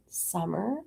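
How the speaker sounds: slow, drawn-out patient instruction; voice of God. Retold or imitated. God sending her back to the dishes, slow patient instruction. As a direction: spell it out slowly, as God to a stalling child. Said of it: "And God said".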